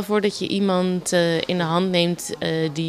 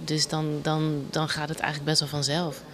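normal talking voice

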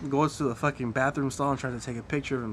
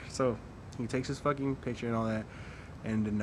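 ordinary talk

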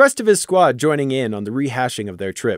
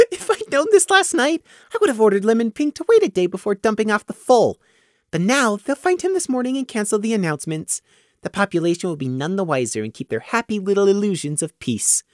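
put-on higher, smug young voice; smug young pony; 9 semitones higher than usual. Prey's smug inner thoughts.

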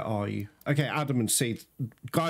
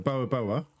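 ordinary talk, nothing marked

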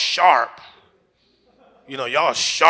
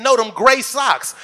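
higher, louder, slow demand; mock girlfriend, louder and higher; woman's insistent voice; 8 semitones higher, 8 dB louder, slower than usual.

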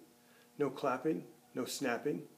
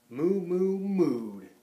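higher, louder mooing like a cow; 4 semitones higher, 6 dB louder, much slower than usual. A narrator's reading. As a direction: moo it out like the cows. Cows mooing in story.

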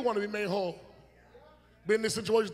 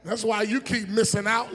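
normal talking voice

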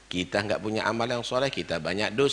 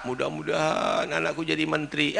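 imitating a parent's prayer; 9 semitones higher than usual. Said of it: "Doanya apa?".

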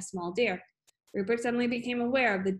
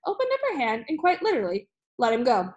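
plain reading voice

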